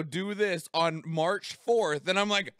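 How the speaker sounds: normal talking voice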